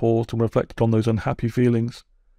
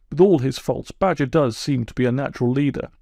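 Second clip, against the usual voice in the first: ordinary delivery, nothing marked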